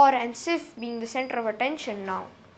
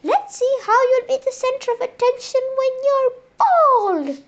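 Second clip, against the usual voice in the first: much higher, gloating threat; gleeful trickster; 11 semitones higher, 3 dB louder than usual. Acted. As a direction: relish the cruel prank. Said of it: "he said".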